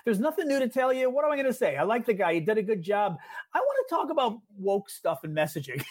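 high, hammed-up imitation of a bored pundit; mock James Carville. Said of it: "Carville starts the interview by going".

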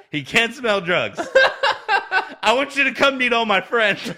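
can barely get the punchline out for laughing; laughing through it. Retold or imitated. Podcast host retelling wrestler's airport prank to cohost, laughing hard.

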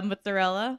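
"mozzarella" with the final "ah" sounded; full ending. Shown as a demonstration. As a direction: say all syllables of "mozzarella". Full form before Jersey version.

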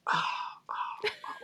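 soft voiceless "ah", repeated with gaps; stunned breathy gasps. Retold or imitated. Actor leaves rejected audition, soft stunned gasps. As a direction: gasp quietly, lost for words.

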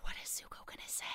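fast hushed whisper; a viewer holding their breath, whispering; breathless whisper. Retold or imitated. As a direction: whisper it fast, on the edge of your seat. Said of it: "you just take this deep gasp of air".

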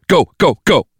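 high, loud rapid-fire order; sharp military shout; urgent command. Acted. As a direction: send the team charging. Team leader orders a charge.

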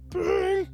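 high, thin ringtone noise; phone ring. Acted.

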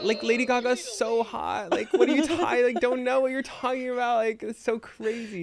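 high-pitched, hammed-up protest; flustered denial.

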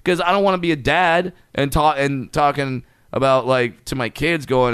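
ordinary talk, nothing marked

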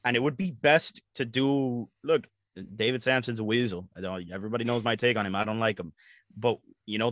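usual speaking voice

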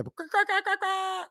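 high squawking goose call; goose call imitation. Imitates geese calling out from rooftops with a high squawk. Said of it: "I did the same noise three times".

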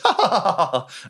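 high, hollow forced laugh; put-on obliging laughter. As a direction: fake a sycophantic crowd laugh. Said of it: "And forced laughter from everyone".

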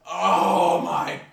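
drawn-out wailing shout of despair; slow, loud, anguished wail; anguished fan cry. Retold or imitated. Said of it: "You just picture them going".

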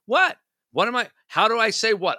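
high, rising indignant "what?"; baffled, defensive. Acted. Spouse questioning partner's complaint, defensively incredulous. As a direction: protest as if unjustly accused.

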